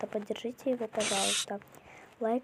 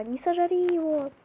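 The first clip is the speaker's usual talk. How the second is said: mock pleading; 6 semitones higher, 7 dB louder than usual. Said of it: "типа такая".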